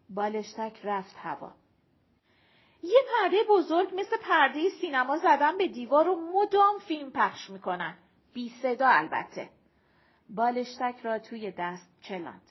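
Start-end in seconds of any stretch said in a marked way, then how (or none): 2.8-9.5 lightly voiced character, high and loud; eager young woman. Ayeh describing the rooftop restaurant's screen, eager.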